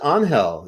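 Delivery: Angel said "ahn-HELL"; explanatory "ahn-HELL" for Angel; Spanish pronunciation. Shown as a demonstration. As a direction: stress the second syllable of Angel, g as h. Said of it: "some people pronounce it Angel".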